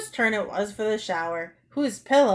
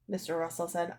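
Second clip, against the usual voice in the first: ordinary delivery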